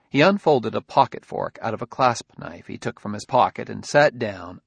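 ordinary delivery, nothing marked

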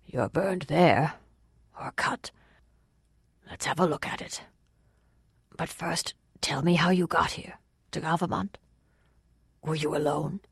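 soft, half-whispered, soothing voice; mild, peaceable Waylord. A narrator's reading. Waylord looking over the fugitive's burns, mild and hushed. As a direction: question gently and quietly while tending a wound.

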